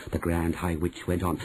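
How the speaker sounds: usual speaking voice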